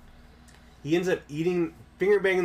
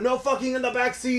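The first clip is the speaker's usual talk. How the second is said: freaked-out taxi driver; 12 semitones higher than usual. Retold or imitated. Russian cab driver yelling at backseat passengers, freaked out. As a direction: bark it like the outraged driver from the front seat. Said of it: "the fucking taxi driver freaks out".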